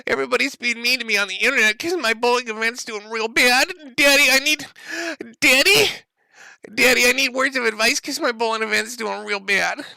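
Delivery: put-on high, whining man-child begging his daddy; whiny Ethan impression. Streamer mocking Ethan begging daddy for advice, high whiny voice. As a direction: whimper it up high like a pouting grown son. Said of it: "But he decided".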